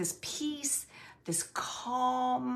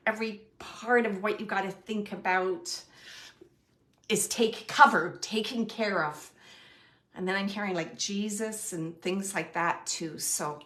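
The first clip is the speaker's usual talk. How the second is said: usual speaking voice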